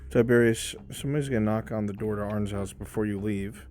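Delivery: normal talking voice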